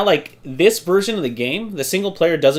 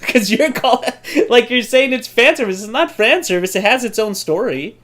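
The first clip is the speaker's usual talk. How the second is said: high-pitched, exasperated protest; getting upset; 7 semitones higher than usual. Real, not acted. Co-host defends a game against the fan service label, upset. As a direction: insist indignantly, pitch up. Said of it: "why you're getting upset".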